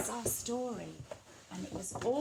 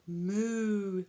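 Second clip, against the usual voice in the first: cow moo. Pretend. Storyteller making animal sounds for children, cow moo. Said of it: "make the sounds that the animals make".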